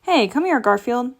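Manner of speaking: ordinary delivery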